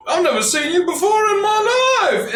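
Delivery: high, loud denial; high, indignant young rabbit; flustered rabbit. Acted. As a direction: protest your innocence like a startled rabbit. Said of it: "he looks at you like".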